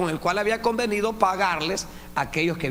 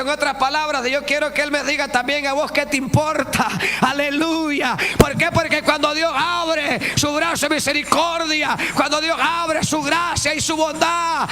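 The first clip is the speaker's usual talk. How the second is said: high, loud fervent preaching; full-force preaching; 10 semitones higher, 5 dB louder than usual. Acted. Preacher exhorting the brethren, high and loud. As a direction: drive the point home.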